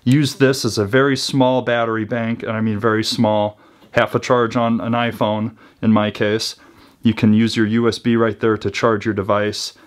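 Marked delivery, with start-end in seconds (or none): none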